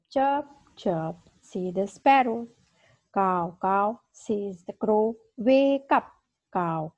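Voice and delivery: slow, sing-song classroom recitation; reading a poem to children. Acted. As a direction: read it aloud like a teacher leading young pupils. Teacher reads poem to class.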